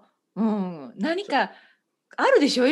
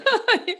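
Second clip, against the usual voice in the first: short laugh. Real, not acted. Laughs at the misheard word.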